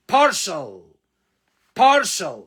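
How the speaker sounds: Partial with a loud puff of air on the P; Partial, P pushed out hard with air; aspirated P. Shown as a demonstration. Teacher drilling the P in Partial for students. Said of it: "ولازم ال P هنا تنطقها".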